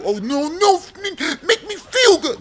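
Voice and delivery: high, squeaky mocking whine, cracking into a laugh; mock whiny plea. Sneering at viewers who want comforting, in a high mock whine.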